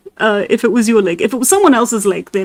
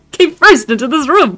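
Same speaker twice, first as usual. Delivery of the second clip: higher voice, breaking into laughter; laughing; 6 semitones higher, 4 dB louder than usual. Real, not acted. Reader retaking the narrator's line after cracking up, laughing. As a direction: try the line through real laughter.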